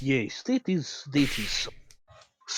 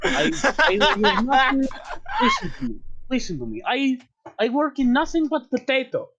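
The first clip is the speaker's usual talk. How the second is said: put-on terrible Russian accent; 8 semitones higher than usual. [name] ranting about potato and AK-47 in a terrible Russian accent. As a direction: shout it in a hammed-up Russian voice. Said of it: "use this terrible accent".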